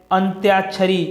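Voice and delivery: अंत्याक्षरी said clearly as "ant-yaak-sha-ri" with the y sounded; correct pronunciation. Shown as a demonstration. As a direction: pronounce अंत्याक्षरी with a clear य after अंत. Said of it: "जो शुद्ध रूप होता है". (अंत्याक्षरी का शुद्ध उच्चारण)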